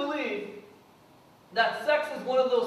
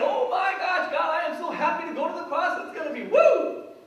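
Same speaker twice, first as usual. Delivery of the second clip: higher, louder, fast, giddy and delighted; mock gleeful prayer; 7 semitones higher, 7 dB louder than usual. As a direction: ham up a thrilled, carefree Jesus. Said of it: "he's not there praying".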